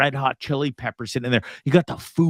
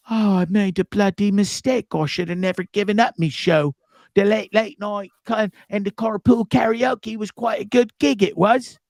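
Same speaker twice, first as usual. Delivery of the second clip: a rueful mock British voice, pitched higher; a Brit regretting quitting his show, hammed up; mock British accent; 5 semitones higher than usual. Imagining Corden's regret.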